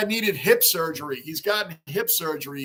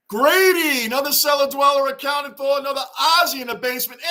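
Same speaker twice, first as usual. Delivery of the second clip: high, loud announcer-style welcome; excited shout-out; 6 semitones higher, 5 dB louder than usual. Real, not acted. Streamer greeting a fellow cellar dweller, excited shout-out. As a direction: belt out a big welcome.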